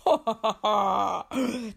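big proud genie laugh; booming genie laugh. Acted. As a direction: laugh like a foolish giant. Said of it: "se mit à rire".

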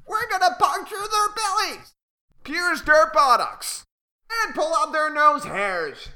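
high, squeaky gang of birds; rowdy chicks itching for a fight; excited little birds. Acted. The weaver gang rallying to attack the bullies, high and excited.

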